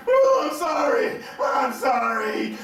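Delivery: high, slow whimpering apology; imitating a whimpering apology; mock whimpering. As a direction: whine it out like a scared driver. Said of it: "she was still whimpering".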